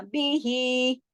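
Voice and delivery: बी ही, very slow, ही held for two beats; stretched recitation. Shown as a demonstration. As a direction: draw out the ही of बी ही. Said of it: "हा खड़ी जेर ही". (खड़ी जेर का पूरा हक़, धीरे से बी ही)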